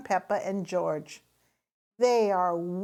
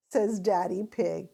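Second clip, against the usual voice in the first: plain reading voice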